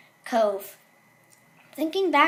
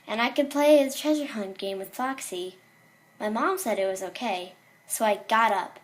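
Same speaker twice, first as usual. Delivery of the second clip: ordinary delivery, nothing marked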